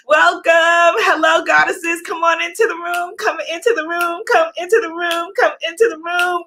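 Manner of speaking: high, quick, repeated chanting invitation; welcoming call. Real, not acted. Host welcoming goddesses into the room, high chanting call. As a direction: call them in on one high pitch. Host calling viewers into the livestream.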